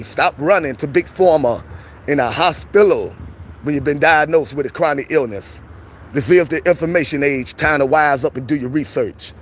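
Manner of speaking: ordinary delivery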